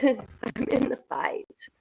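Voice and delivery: unvoiced, slow whisper. Real, not acted. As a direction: breathe it as a secret vow. Whispered repeat after laugh.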